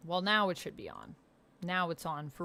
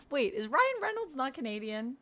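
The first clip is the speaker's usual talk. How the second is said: real high-pitched, puzzled double-take; confused; 6 semitones higher, 3 dB louder than usual. Real, not acted. Streamer doubting Ryan Reynolds is Canadian, high and confused. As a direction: question it in high, confused disbelief. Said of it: "now I'm confused".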